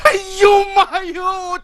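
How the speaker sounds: howling ouch; pained yelp. Acted. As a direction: yelp as the blow lands. Servant cries out being beaten.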